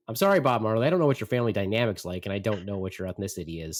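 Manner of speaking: ordinary talk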